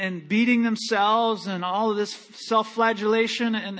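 ordinary talk, nothing marked

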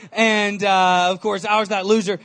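usual speaking voice

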